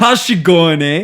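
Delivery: playful mock Canadian; Canadian accent. Teasing co-host with a mock Canadian accent. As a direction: lean into the Canadian, eh. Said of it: "I sound so Canadian".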